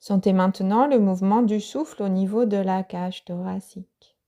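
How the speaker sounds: usual speaking voice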